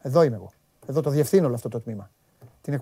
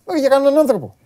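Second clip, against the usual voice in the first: loud, raised objection; heated protest; 8 semitones higher, 8 dB louder than usual. Real, not acted. Arguing with co-host about wishing injury, loud. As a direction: push back hard on your co-host.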